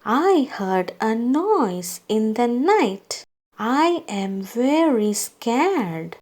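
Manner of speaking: ordinary delivery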